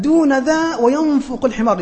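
blurted punchline. Retold or imitated. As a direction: let it slip out loud and high. Seller's helper blurting out the punchline.

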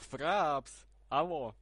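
loud shout down the line; calling out loud. Real, not acted. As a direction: shout it so they hear.

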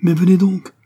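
high, loud, forceful urging; violent cry. A narrator's reading. Young man dragging the count along, violent cry. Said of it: "s'écria le jeune homme avec une certaine violence".

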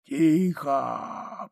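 hoarse, strained croak; dying soldier's wheeze; wheezing. A narrator's reading. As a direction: rasp it out with failing breath. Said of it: "прохрипел солдат".

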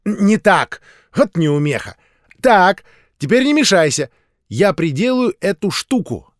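put-on a busy little girl bossing; bossy commands. A narrator's reading. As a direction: order playmates around impatiently. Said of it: "командовала мышка".

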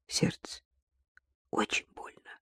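breathless whispered pain. A narrator's reading. Tanya telling her children of her heart, whispering. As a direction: barely get the words out.

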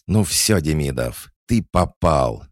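high, loud, furious threat; angry growl. A narrator's reading. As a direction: burst out threatening your opponent. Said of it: "злобно прорычал Апраксин".

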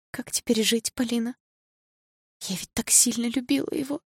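high, thin-edged crying voice; tearful lament. A narrator's reading. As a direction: plead in grief, on the verge of sobbing. Grieving girl asks how to live.

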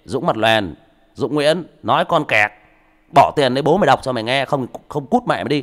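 loud, insulting jeer; rude thug voice. Retold or imitated. Mocking a rude listener, loud jeering. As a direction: bark it like a rude punk. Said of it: "vài thằng mất dạy nó sẽ nói anh như thế".

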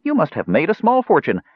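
high-pitched, gushing flattery; hurrying on. A narrator's reading. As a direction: talk him over before he can answer. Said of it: "He hurried on".

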